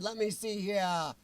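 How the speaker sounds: normal talking voice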